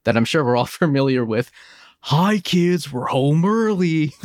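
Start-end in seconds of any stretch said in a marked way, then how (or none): 2.0-4.1 put-on drawn-out, higher commercial-kid call; lightly mocking ad voice, stretched out; commercial impression. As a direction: imitate an old toy ad line. Mimics a famous toy commercial line.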